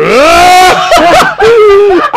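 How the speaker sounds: high, loud howl of laughter. Real, not acted. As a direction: crack up loudly. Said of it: "the bag, she's midair".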